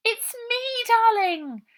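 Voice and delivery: high, loud posh lady's fuss; kind and posh. A narrator's reading. Posh lady fussing over the trapped girl, high and kind. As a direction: coo over her like a fussing aunt. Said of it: "speaking as if she knew Ida".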